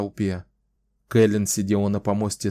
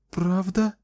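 surprised; 10 semitones higher than usual. A narrator's reading. Kahlan surprised question.

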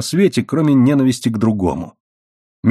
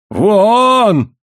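long, high, wailing yell; enraged scream; 10 semitones higher, 5 dB louder than usual. A narrator's reading. Husband fears his wife yet orders her out, screaming. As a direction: hold the word in a high, loud scream.

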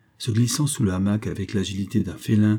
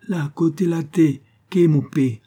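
lightly voiced Creole, raised pitch; Creole speech; 7 semitones higher, 4 dB louder than usual. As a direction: speak Guianese Creole, a little high. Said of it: "Là, à terre avec mon père".

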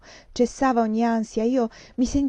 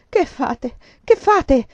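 distressed cry, climbing louder and higher; sobbing protest; 5 semitones higher, 8 dB louder, much slower than usual. A narrator's reading. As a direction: plead in alarm, close to sobbing.

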